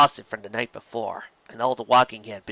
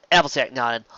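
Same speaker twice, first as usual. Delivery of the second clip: plain reading voice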